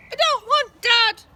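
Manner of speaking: put-on very high, loud, slow toddler bellow; bellowing baby. A narrator's reading. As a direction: bawl it like a furious toddler. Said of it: "bellowed the baby".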